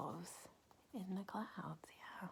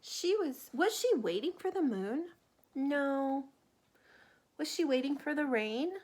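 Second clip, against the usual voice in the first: higher questioning storybook voice; reading to children; 4 semitones higher, 7 dB louder than usual. Acted. Asking the child about the cat, playful reading. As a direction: ask each question playfully.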